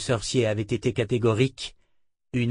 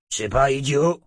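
growling thug; 3 dB louder than usual. Acted. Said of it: "grogna un des violeurs".

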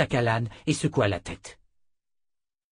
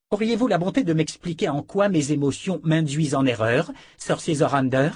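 higher, pointedly formal question; indignant challenge; 6 semitones higher, 4 dB louder than usual. A narrator's reading. Callan challenges the sorcerer who corrected her, indignant challenge. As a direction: demand an explanation with icy courtesy.

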